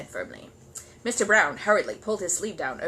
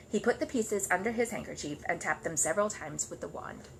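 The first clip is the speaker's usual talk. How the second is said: ordinary delivery, nothing marked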